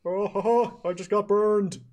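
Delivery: high put-on comic teacher voice; burned teacher playing for laughs, high-pitched; Robin Williams-style teacher. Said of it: "in a Robin Williams-esque routine".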